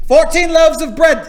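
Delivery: lightly put-on Irish accent for the stern nun. Retelling the nun's headcount, in a mock Irish accent. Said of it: "She had an Irish accent".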